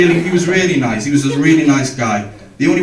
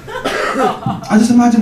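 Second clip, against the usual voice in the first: ordinary delivery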